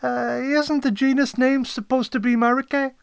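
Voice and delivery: high, loud questioning sidekick; puzzled assistant querying the boss; assistant Kodet. Acted. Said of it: "he asked".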